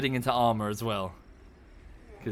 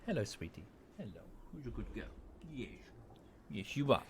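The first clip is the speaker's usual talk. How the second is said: cooing to a dog; 13 dB quieter, faster than usual. Real, not acted.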